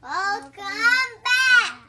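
shouted, very slow echo; loud echo. Real, not acted. Child shouting back the morning message. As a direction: bellow it back word by word. Child echoes teacher loudly.

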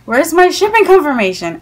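retold complaint, pressing hard for an answer; exasperated demand. Repeated acted demand, more insistent.

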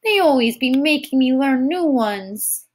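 put-on high, complaining toddler voice; scowling small child. A narrator's reading. Tyke complains about new songs, high sulky child's voice. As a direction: grumble like a put-upon little one. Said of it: "The tyke scowled".

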